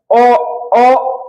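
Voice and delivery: ordinary talk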